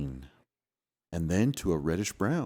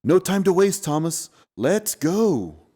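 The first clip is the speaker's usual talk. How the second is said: high, loud call to hurry; excited shout, no time to lose; 9 semitones higher, 6 dB louder than usual. Acted. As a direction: shout it, eager to run. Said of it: "jumped up and shouted".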